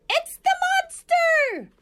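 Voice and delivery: shrieking high loud owl; owl screech. A narrator's reading. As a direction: screech the alarm. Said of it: "and screeched".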